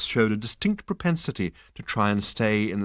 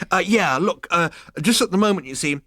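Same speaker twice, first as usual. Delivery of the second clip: flustered Zaphod; 9 semitones higher, 5 dB louder than usual. A narrator's reading.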